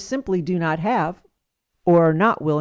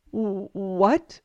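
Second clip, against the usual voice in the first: stammered, baffled question; acted confusion with a false start; startled stammer. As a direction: stumble into a bewildered question. Chuck startled by the gown order.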